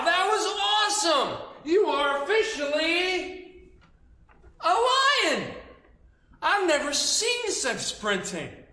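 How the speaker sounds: very high, loud, amazed praise; thrilled lion instructor. Retold or imitated. Big lion instructor praising the pupil, thrilled. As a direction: gush over the pounce.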